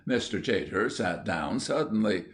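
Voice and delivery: plain reading voice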